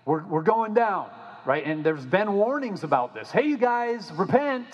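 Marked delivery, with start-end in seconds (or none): 3.3-4.7 prophet's warning. Retold or imitated.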